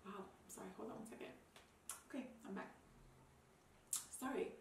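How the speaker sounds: pulling herself together after crying; teary and quiet. Real, not acted. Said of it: "I didn't expect to get that emotional".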